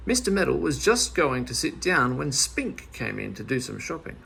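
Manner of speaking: ordinary delivery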